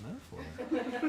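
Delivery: word dissolving into a laugh. Real, not acted. Laughing at absurd AI metaphor.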